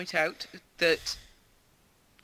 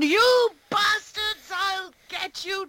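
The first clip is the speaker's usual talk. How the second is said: slow, much higher, louder hammed-up yell; mock furious shout; 12 semitones higher, 7 dB louder, much slower than usual. Imitating Brian Blessed raging at his brother, hammed-up shout. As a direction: bellow it like a ham villain. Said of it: "he keeps telling, um, Leonardo".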